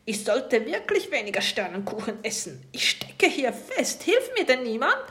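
höher und lauter, zum Schluss langsam; jammernder Hilferuf. A narrator's reading. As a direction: klagend um Hilfe rufen. Pirat steckt im Fenster fest. (Pirat klemmt im Fenster und ruft laut um Hilfe)

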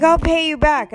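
high-pitched mock protest; temper tantrum. Offering her money instead, mock petulant. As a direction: imitate a petulant counter-offer. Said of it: "throwing a temper tantrum".

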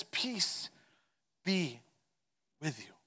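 soft, slow and spaced, fading away gently; tender greeting. Acted. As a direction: let each word settle to calm them. Jesus greets frightened room.

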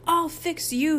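high-pitched spluttered threat; spluttering threat. A narrator's reading. Soaked Harold threatens the boys, spluttering. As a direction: splutter it indignantly. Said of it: "he spluttered".

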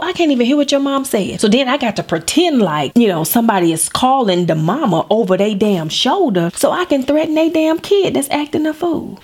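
ordinary talk

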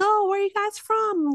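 high, bright tour guide impression. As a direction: put on a chipper guide voice. Tour guide voiced in story.

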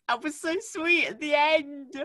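high, loud, stretched-out delight; gushing squeal. Real, not acted. Fan gushing to co-host about a sweet scene, delighted squeal. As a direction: squeal it slowly, overcome by the sweetness.

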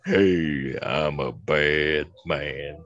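low, slow bad-guy voice; Mister Big Joe. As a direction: drop low and drawl it out like a tough guy. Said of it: "Mister Big Joe saying".

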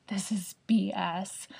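slow unvoiced whisper of frustration; whispered gripe. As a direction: whisper it, fed up. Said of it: "And I was just like".

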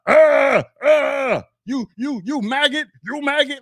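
high, loud stammering insult; mock him losing it mid-insult; imitated furious sputter; sputtering rage. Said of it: "getting this irate and angry".

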